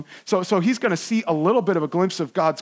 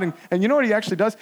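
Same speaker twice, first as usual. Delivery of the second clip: ordinary talk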